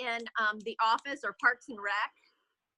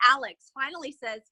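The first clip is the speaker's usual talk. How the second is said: ordinary talk, nothing marked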